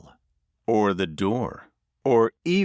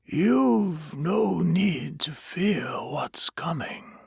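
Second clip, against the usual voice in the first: high, slow whisper; soothing whisper; 9 semitones higher, slower than usual. A narrator's reading. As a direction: stranger whispering comfort to a dying man. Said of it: "the stranger continued".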